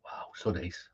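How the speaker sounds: breathy whispered aside, almost to himself; a hushed, appreciative whisper. Real, not acted. Savouring his whisky, a soft whispered wow. Reacting quietly to the dram.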